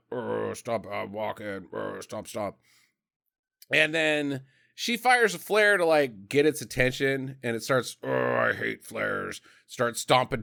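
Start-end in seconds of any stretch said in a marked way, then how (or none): 0.1-2.6 low, soft, grumbling troll; lumbering creature mumbling to itself, low; stomping troll. Retold or imitated. Megatroll coming in from outside, low troll voice. 8.0-9.4 low, soft troll complaint; grumbling troll. Retold or imitated. Troll stomping toward a flare, low mock grumble.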